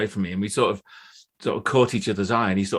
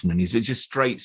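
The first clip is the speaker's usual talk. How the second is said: ordinary delivery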